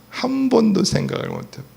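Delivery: ordinary talk, nothing marked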